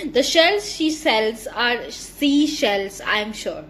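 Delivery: slow, deliberate tongue twister reading. Shown as a demonstration. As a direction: spell each sound out slowly. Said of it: "Read aloud the following tongue twisters". (धीरे-धीरे टंग twister)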